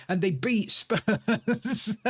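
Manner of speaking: breaking into laughter on a drawn-out last word; slow down gleefully and crack up at the end; amused laughing. Real, not acted. Fan recalls rival Spurs being beaten, laughing.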